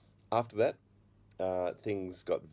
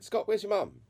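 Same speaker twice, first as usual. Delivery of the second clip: high, loud questioning aunt; raised-voice imitation of an aunt; demanding aunt; 5 dB louder than usual. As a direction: call out sharply as a concerned aunt. Aunt demanding missing mother's whereabouts.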